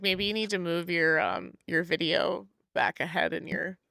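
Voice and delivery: ordinary talk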